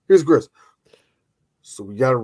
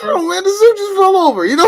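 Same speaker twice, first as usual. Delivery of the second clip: high, whiny mock sorrow; hammed-up pitiful whine; mock lament; 4 dB louder than usual. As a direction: moan about the suit.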